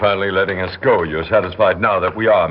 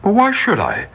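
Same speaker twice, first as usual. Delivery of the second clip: indignant; 10 semitones higher than usual. Acted. Refusing detective's request.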